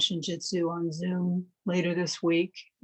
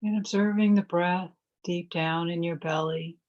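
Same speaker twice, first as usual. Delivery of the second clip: calm, measured phrases with long silences between; guided meditation. Real, not acted. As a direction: guide listeners gently into stillness, leaving space to breathe.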